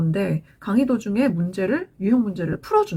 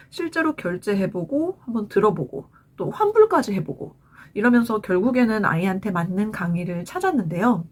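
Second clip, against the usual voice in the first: ordinary talk, nothing marked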